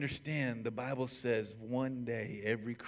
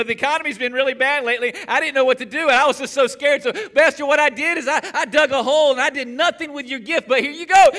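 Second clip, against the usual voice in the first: high, loud, nervous pleading servant; anxious servant with nervous laughs; scared servant; 12 semitones higher, 14 dB louder than usual. Acted. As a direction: whine it out like a frightened servant. Servant voiced in parable.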